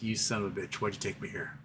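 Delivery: lightly voiced resentful dog; grumpy dog. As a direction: mutter it like a miserable overheated pet. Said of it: "She'd just look at me like".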